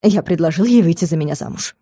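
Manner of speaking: ordinary delivery, nothing marked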